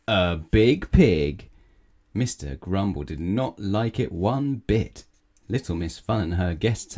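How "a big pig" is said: very slow, loud drawn-out reveal; dramatic reveal. A narrator's reading. Wizard turning grumbler into pig, slow dramatic reveal. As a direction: stretch each word out with force. Wizard announces bigger punishment.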